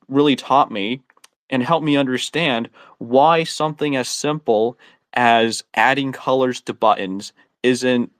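normal talking voice

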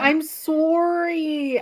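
high, loud, drawn-out Canadian "sorry"; Canadian accent. Retold or imitated. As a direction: stretch it out in a hammed-up Canadian accent. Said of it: "with a Canadian accent".

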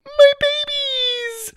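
very high, slow joyful squeal; squealing delight. Acted. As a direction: squeal with love for babies. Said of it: "cried Susie".

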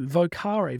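normal talking voice

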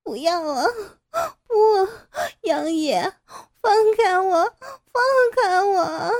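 high, loud sobbing scream; terrified woman screaming and crying at her captor. Acted. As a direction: wail through tears, rising to a shout. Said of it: "傅菊英拼命地哭喊着".

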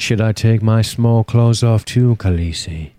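put-on suitor's voice, dropping at the end; Jorah Mormont. Co-host teasing the other as her knightly admirer, in character. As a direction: play the devoted knight addressing his queen. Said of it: "Don't talk in the Jorah Mormont voice".

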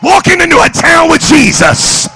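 full-force, high, shouted sermon; excited preaching. Real, not acted. Preacher rouses congregation about walking with Jesus, excited shout. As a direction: preach it at the top of your voice. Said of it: "if I'm getting excited".

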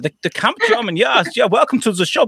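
high, hammed-up camp German accent. Host greeting podcast listeners in a camp German accent. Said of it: "the Camp Germans".

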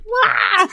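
high squeaky wah; startled yelp. Retold or imitated. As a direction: yelp as if caught off guard. Startled in the shower.

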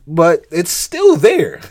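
slow and loud, "still" stretched and hit; emphatic. Real, not acted. Preacher to listeners about covered-up pain, slow loud stress. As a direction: press down on "still". Preacher driving point home.

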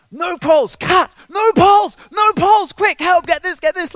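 frantic high yelling vet; panicked shouting. Retold or imitated. The panicking vet yelling at his assistant about the animal, frantic high shout. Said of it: "he's panicking".

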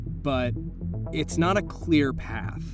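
usual speaking voice

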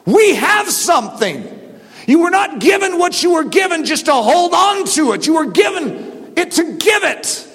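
high, driving pulpit exhortation; forceful preaching. Real, not acted. Preacher urging church to give, forceful exhortation. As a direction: press it home at full force.

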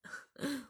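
a quick quiet laugh; short soft chuckle. Acted. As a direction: snicker at her. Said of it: "boyfriend laughs at her". (轻声偷笑)

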